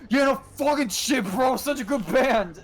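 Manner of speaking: high, loud mock shout, slowing at the end; overexcited punk fan yelling, imitated. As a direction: scream it like a fan who can't hold it in. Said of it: "yells even louder".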